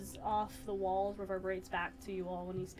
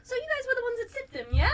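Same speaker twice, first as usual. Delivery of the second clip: high voice; 10 dB louder, faster than usual. Acted. Council figure questions party.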